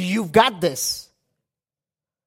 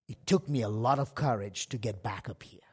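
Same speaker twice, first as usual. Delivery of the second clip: a real whisper, almost breathless; whispered aside; 11 semitones lower, 10 dB quieter than usual. Real, not acted. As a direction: drop to a private whisper, confessing. Quiet personal aside.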